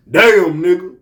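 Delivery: a lightly imitated sudden loud mad outburst. Mocking a star's real voice when he is mad, loud outburst. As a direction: bark it out like a star who finally snaps. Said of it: "he would go like that when he was really mad".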